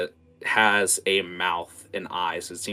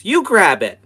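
put-on a rude little girl's retort, much higher and louder; bratty child; 12 semitones higher, 15 dB louder than usual. Little girl to the woman, refusing the chore, high and bratty. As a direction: sass the grown-up like a spoiled kid. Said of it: "And she again says".

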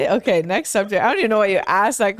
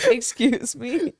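mock offended; 4 semitones higher, much slower than usual.

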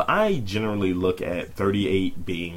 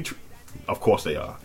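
ordinary delivery

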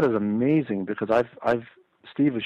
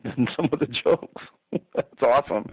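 laughing; 3 semitones higher than usual. Real, not acted. Laughing at bomb lines.

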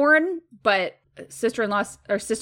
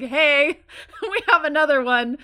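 laughing; 6 semitones higher, 4 dB louder than usual. Pretend. Mocking sister's announcement, laughing.

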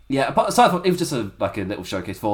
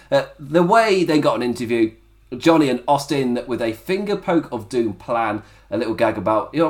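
usual speaking voice

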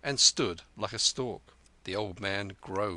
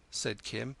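ordinary delivery

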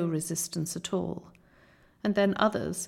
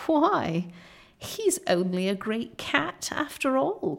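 creature's scornful cry; 6 semitones higher than usual. A narrator's reading. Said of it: "cried one".

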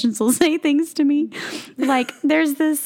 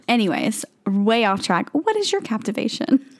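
usual speaking voice

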